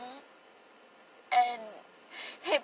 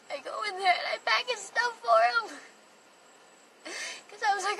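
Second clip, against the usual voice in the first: ordinary talk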